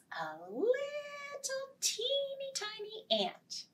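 put-on high, slow teeny tiny. A narrator's reading. Storyteller to children, describing the tiny ant high and slow.

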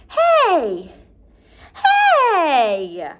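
loud high yell; shouting. A narrator's reading. Girl yelling at the pigeons, loud and high. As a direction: shout at the flying birds.

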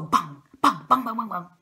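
loud, punched rapid-fire bangs; imitated gunshots. A narrator's reading. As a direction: fire the words out like shots. Farmer acts out the gunshots. (høye, smellende skuddlyder)

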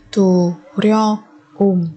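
slow, drawn-out repeat for learners; slow drill. Shown as a demonstration. Teacher to students, slow syllable-by-syllable repeat. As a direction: stretch each syllable for the class. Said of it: "teacher repeats word slowly".

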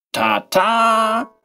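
high, showy ta-da of a magician; solemn flourish. A narrator's reading. Mechanical boy unveiling his bouquet, high and grand. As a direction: present the trick with a grand flourish. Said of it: "rief er feierlich aus".

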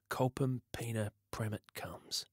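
soft, half-voiced recital; mouthing the words. A narrator's reading. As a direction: sound the words out softly to yourself. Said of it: "mouthing the words".

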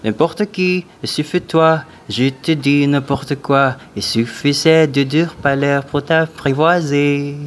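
mock French singing; switching accents clumsily. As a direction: sing in a hammy French accent. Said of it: "impossible for me to do French like".

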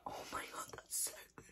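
breathless awed whisper. Real, not acted. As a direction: whisper it in awe. Stunned by flavour.

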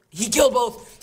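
hyped gamer calling a double kill; excited call. Real, not acted. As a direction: shout it as the kill lands.

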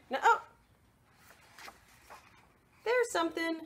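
ordinary delivery, nothing marked

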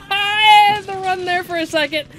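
loud, high, breathless excitement; excited shout. Real, not acted. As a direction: blurt it out still buzzing. Excited after close call.

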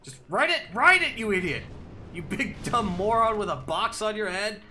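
loud angry yelling at teammate; raging gamer. Real, not acted. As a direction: scream at your buddy.

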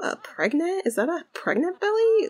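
high, incredulous question; shocked surprise. Acted. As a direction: act stunned at the belly. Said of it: "And Sienna's like".

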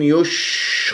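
wrong يش with the u dragged into the sh; vowel dragged into shin. Shown as a demonstration. Teacher showing a recitation error, dragged يش. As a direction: pull the rounded u on through the shin of يش. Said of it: "لا تسحب ضمة الياء إلى الشين".

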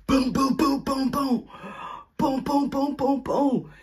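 high, punchy boom boom knocks; knocking sounds done high; door banging. Retold or imitated. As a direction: imitate pounding on a door.

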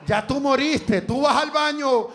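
ordinary delivery

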